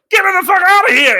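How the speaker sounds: high, loud, fast yell; panicked shout. Acted. Guard running from the emu, panicked high shout. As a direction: shout in hammed-up alarm while fleeing. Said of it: "running, it's like".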